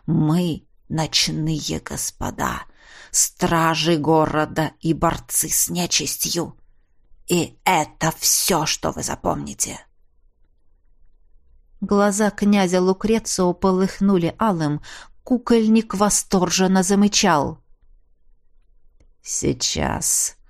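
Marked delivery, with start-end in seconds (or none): 0.0-9.8 low villain's hypnotic threat; menacing vampire lord. Acted. 19.2-20.4 low, soft, drawn-out threat; menacing vampire. Acted. Vampire continues his spell.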